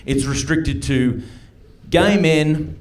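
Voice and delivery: slow, measured newsreader imitation; news lady. News lady announces the outbreak's three main groups, slow newsreader delivery. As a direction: read it out like a bulletin, with deliberate pauses. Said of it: "the news lady said this about it".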